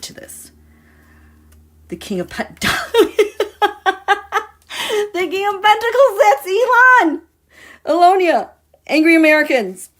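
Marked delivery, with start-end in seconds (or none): 2.7-4.4 high burst of real laughter; sudden high laugh. Real, not acted. Laughing at the tarot card. 5.1-9.9 high and loud, tumbling out fast; high, loud, excited rush; excited reveal. Real, not acted. Excited reveal of tarot card.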